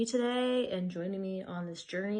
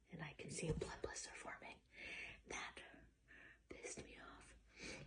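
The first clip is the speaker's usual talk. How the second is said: annoyed whisper, much softer; 4 semitones lower, 21 dB quieter than usual. Real, not acted. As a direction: whisper it, stung and irritated. Annoyed at blister.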